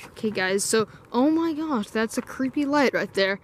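usual speaking voice

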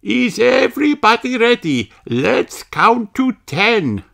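high teacher voice, rushing then slowing; Madame Gazelle. A narrator's reading. Teacher starting the count, high and lively. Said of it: "says Madame Gazelle".

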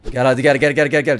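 hearty come-here call. Real, not acted. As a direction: call him over, loud and eager. Calling the baby over.

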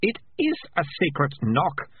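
high-pitched irritated retort; mildly snapped contradiction, pitched high; snappy. A narrator's reading. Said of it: "Melicia snapped".

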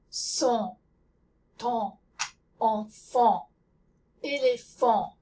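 "on", "pendant", "enfant" with rounded lips and nasal vowels, no n or m sounded; repeating the correct French nasal words. Shown as a demonstration.

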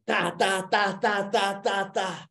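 even, high "ta" machine-gun noises; rat-a-tat made with the mouth; imitated gunfire. Imitates second try at shooting.

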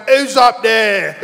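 put-on loud, drawn-out drunken call; drunk man. Drunk passenger calls up to unseen rival, slurred drunk call. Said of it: "He was so drunk".

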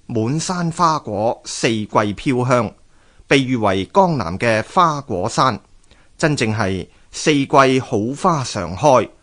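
ordinary delivery

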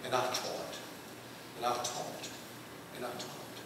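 dropping to a near whisper each time; fading refrain. Acted. Preacher telling the congregation how he kept teaching, fading to a whisper. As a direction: let it fade away.

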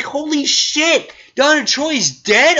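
high, slow shocked gasp of disbelief; reeling at a shock twist. Retold or imitated. Reviewer retelling his shock at a character's death, high and stunned. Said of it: "And I was like".

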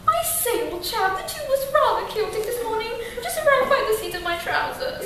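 hammed-up British gentleman; British accent. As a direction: play a posh British chap. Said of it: "you could probably say".

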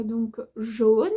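"jaune" slowly drawn out with a closed o; exaggerated standard pronunciation. Shown as a demonstration. Vlogger poking fun at her accent, exaggerated standard "jaune". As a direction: overdo the standard "jaune", stretched and rising. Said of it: "et pas avec mon accent".

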